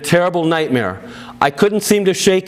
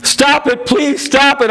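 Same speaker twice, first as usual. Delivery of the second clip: much higher, urgent plea; desperate pleading; 9 semitones higher than usual. A narrator's reading. Daughter pleading with her bleeding father, urgent and much higher. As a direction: beg him to stop. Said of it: "I pleaded".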